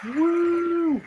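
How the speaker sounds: high whooping cheer. Pretend. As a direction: cheer like a fan in the crowd. Cheering along with a speech.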